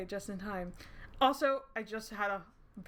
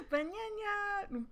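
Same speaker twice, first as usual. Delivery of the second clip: lightly voiced minion, high and slow; minion impression; 11 semitones higher, much slower than usual. Gamer doing a mild Minion voice after being called a minion. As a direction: cheerfully chirp like a Minion. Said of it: "Or whatever the fuck they say".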